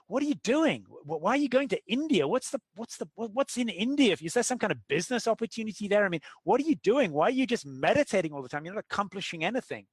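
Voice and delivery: high-pitched, baffled, scolding questions; incredulous banker brother. Retold or imitated. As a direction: needle him like a brother who thinks he's wasting his life.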